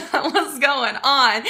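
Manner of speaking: slow, through a laugh; laughing greeting. Real, not acted. Greeting a viewer while still laughing. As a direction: let the laugh carry the words.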